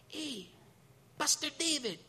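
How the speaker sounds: put-on small, high child's voice calling up; tiny boy's greeting. A tiny boy greeting his pastor after Bible study, imitated little-kid voice. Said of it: "That's how he said it".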